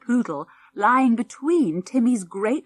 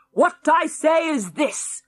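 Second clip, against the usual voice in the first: put-on cranky old man; 8 semitones higher, 5 dB louder, slower than usual.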